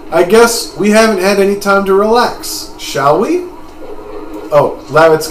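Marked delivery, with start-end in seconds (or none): 0.1-3.5 lightly voiced game character, rising question at the end; Haschel. Haschel suggesting they relax, lightly put-on voice. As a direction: try a slightly lifted character voice. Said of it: "play around with this Haschel voice".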